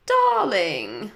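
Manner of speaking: loud, hammed-up English "dahling"; fake English accent. A narrator's reading. Girl greets her best friend, fake English accent. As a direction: put on a theatrical English accent. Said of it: "said in a fake English accent".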